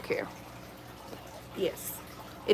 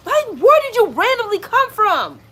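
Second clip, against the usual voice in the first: startled squeal; 12 semitones higher, 5 dB louder than usual. Real, not acted. Startled at a snake.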